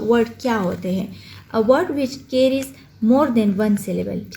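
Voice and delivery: ordinary talk, nothing marked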